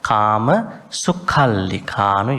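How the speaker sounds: "Kama sukhallikanu" slowly intoned, aspirated kh; controlled emphasis on the kh of "sukhallikanu"; emphasis within intonation. Shown as a demonstration. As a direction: chant "Kama sukhallikanu", leaning lightly on the kh. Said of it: "you do it in your intonation".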